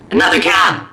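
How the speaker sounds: loud, low exasperated cry; exasperated shout. Retold or imitated. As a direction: yell it out in frustration. Frantic at stopping a cab.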